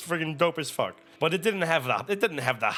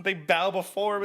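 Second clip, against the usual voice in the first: ordinary talk, nothing marked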